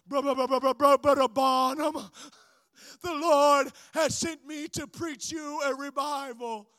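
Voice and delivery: stuttering, labored impaired speech; Charlie Wycliffe. Retold or imitated. Evangelist offering to preach a revival, stuttering impaired speech. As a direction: stammer on the first word, then push the message out. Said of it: "Can't, can't enunciate. Can't speak too well".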